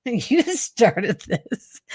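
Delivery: real wobbling laugh while overcome by the poem; emotional laughing. Real, not acted. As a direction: blame them playfully, laughing through emotion. Overcome while reading, blames partner.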